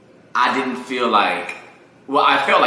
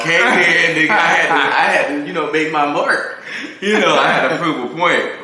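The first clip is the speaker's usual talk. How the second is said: hyped defiant boast; 7 semitones higher, 7 dB louder than usual. Real, not acted. Defiant boast after doubters.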